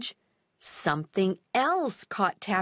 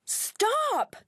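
high wailing plea; wailed; 12 semitones higher than usual. Acted. Molly wails at Taffy Bogle running off, high wail. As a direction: wail it after the runaway dog. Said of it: "wailed Molly".

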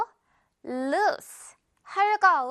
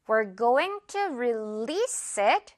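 We're going to release it, very slow and careful; slow example read; much slower than usual. Shown as a demonstration. Teacher reading the example to learners very slowly. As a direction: spell it out slowly for students. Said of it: "예문 볼게요".

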